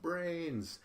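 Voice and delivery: high mock yell of "Brains"; retelling a contestant's scream. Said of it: "she screams out".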